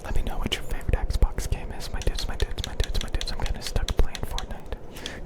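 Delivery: ordinary talk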